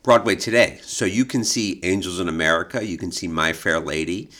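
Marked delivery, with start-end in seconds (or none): none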